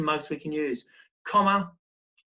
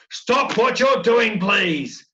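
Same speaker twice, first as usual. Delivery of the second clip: shouted, much higher and louder, slow; loud command; 6 semitones higher, 9 dB louder, much slower than usual. Shown as a demonstration. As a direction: call it out loud like an urgent order to the class. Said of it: "I wanna have those la-, loudness there".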